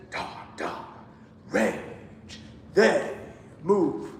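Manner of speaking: each moving note accented in "They move". Shown as a demonstration. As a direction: punch every moving note. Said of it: "can you kind of accent it".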